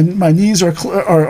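normal talking voice

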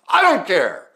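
full-volume acted fury, pitched far up; explosive retold yell; losing it. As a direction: imitate an enraged father screaming at his son. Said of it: "he just lost it".

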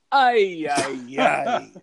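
Italian grandfather's "ay, ay, ay" lament, with a laugh; a light demo of the grandfather's lament for the kids; Italian grandpa lament. Shown as a demonstration. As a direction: groan it like an old Italian nonno, head thrown back. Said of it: "that's the way your grandfather would do it".

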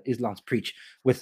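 ordinary talk, nothing marked

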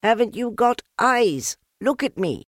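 high, loud shout; squeaky furious piglet. A narrator's reading. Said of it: "shouted Piglet".

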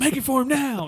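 loud and high, playful demand; loud joking. Real, not acted. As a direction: shout it out, teasing. Friends joking about making shirt.

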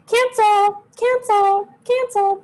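high squeaky repeated cancel; mock cancelling voice. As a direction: toss it off in a high, put-on voice. Mock cancelling plans at work.

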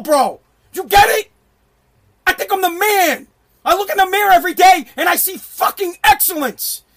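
loud, high-pitched self-belief; emphatic boast. Real, not acted. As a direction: fire it back, loud and proud. Boasts back at imagined critics.

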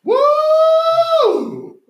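high, loud woo; excited cheer. Real, not acted. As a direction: whoop it up for the new season. Cheering the fifth season.

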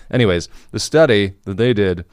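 ordinary talk

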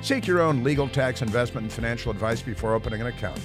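fast legal disclaimer. Acted.

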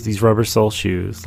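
usual speaking voice

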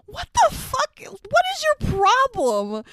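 very high, incredulous squeal of protest; exasperated outburst. Retold or imitated. Host tells off the movie character, high exasperated protest. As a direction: shoot the pitch way up in disbelief.

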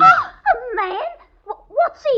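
gasping, high, loud, with a nervous laugh; landlady feigns shock at the man found under her bed, gasping with a laugh. Acted.

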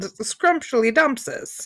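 slow, clumsy fake Shakespearean; badly faked old English voice; mock olde english. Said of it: "yours was a train wreck".

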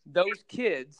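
ordinary delivery

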